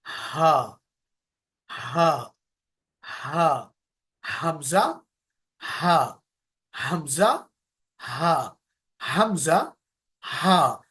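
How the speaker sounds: drilling हमजा and हा from the deep throat. Shown as a demonstration. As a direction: push हा up from the chest. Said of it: "Same with letter हा".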